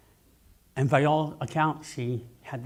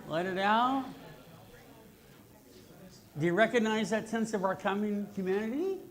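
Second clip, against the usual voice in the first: guided breathing meditation; 9 semitones higher than usual. Acted. Speaker guiding the audience's breathing, calm meditation voice. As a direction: invite everyone to breathe in together.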